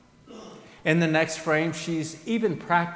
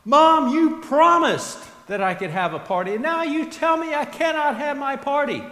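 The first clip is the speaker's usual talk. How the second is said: loud, high wailing complaint at mom; a preacher voicing a child's loud screaming tantrum; bawling, screaming child; 12 semitones higher, 9 dB louder than usual. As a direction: wail it like a tantrum-throwing kid. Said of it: "bawling her eyes out and just screaming".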